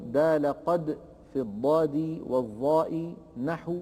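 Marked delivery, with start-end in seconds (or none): none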